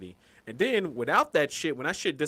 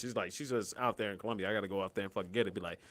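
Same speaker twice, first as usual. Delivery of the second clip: usual speaking voice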